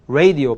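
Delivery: "radio" with the tongue kept off the roof of the mouth; pronunciation demo. Shown as a demonstration. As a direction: say "radio" slowly, tongue never touching the palate. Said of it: "발음 입을 잘 보세요".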